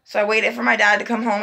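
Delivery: ordinary talk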